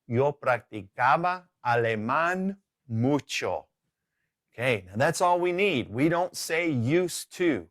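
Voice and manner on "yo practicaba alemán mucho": slow, drawn-out Spanish example; measured teacher's demonstration, stretched out; slow model sentence. Shown as a demonstration. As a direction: model it slowly, word by word.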